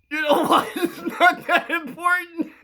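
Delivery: very high, loud, helpless laughter in the words; cracking up. Real, not acted. Playing the kid brushing it off, cracking up. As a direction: squeeze the line out while laughing. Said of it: "I'm sorry, I, I'm, I'm trying to stay".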